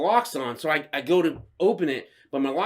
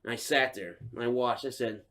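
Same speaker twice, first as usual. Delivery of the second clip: ordinary talk, nothing marked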